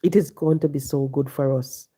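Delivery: ordinary delivery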